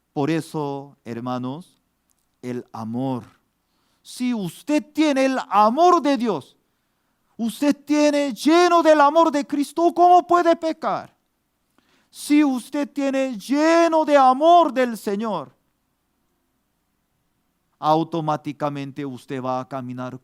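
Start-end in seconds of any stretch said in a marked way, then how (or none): 4.0-15.6 higher, louder preaching voice, pressing each line; fervent preaching. Acted. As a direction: preach it out to the congregation with rising fervor. Preaching climax.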